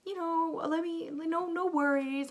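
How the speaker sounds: reassuring midwife. Retold or imitated. Midwife brushing off a worried mother's fear, high and reassuring. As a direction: brush off the worry with a bright high voice. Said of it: "the midwife was really assuring".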